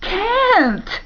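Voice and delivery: one long, very high cry; anguished shriek. A narrator's reading. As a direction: shriek it with all your despair.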